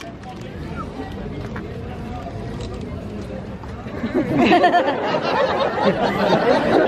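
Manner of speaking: long breathy laugh. Real, not acted. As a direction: burst out laughing. Laughs mid-verse.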